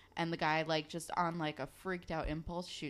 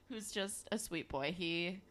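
ordinary talk, nothing marked

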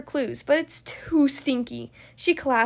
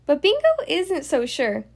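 ordinary delivery, nothing marked

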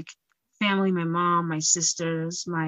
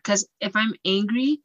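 ordinary delivery

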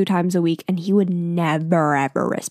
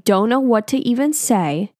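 ordinary delivery

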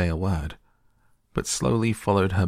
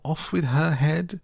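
much higher mock shout; Queen sentences players, much higher; 11 semitones higher than usual. A narrator's reading. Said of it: "quarrelling ... and shouting".

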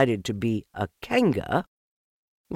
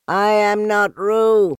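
high, loud, slow protest; little Piglet yelling; 13 semitones higher, 10 dB louder, slower than usual. A narrator's reading. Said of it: "said Piglet loudly".

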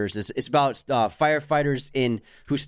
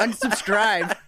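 mock dramatic sign-off; 8 semitones higher, much slower than usual. Ending hater comment.

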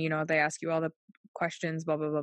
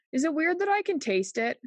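higher, rising tentative question; 7 semitones higher than usual. Retold or imitated. Patient sheepishly asking the nurse, tentative high question. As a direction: ask it high, as if unsure.

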